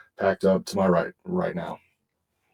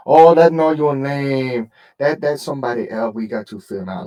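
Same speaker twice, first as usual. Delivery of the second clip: mimicking the woman; 4 semitones higher, 6 dB louder than usual. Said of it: "went from speaking no English to speaking perfect English".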